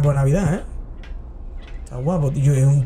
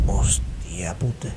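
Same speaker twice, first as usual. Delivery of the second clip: slow, hushed swearing in shock; shocked whisper; 10 semitones lower, 7 dB quieter, much slower than usual. Real, not acted. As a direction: whisper it slowly, under the breath. Shock at news of a death. (susurro de asombro)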